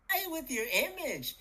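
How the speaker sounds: ordinary talk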